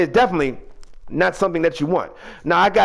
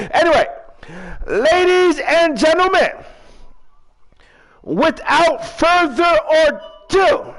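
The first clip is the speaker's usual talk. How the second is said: ring announcer; 8 semitones higher than usual. Pretend. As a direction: belt out the intro to a crowd. Restarts the show intro.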